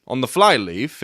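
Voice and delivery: usual speaking voice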